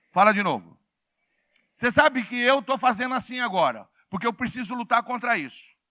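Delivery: very loud, ringing pulpit delivery; full-force preaching. Real, not acted. Preacher rousing congregation to answer, full-force preaching. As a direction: rouse the congregation with big emphatic phrases.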